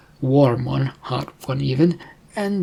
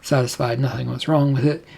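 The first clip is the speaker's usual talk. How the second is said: ordinary delivery, nothing marked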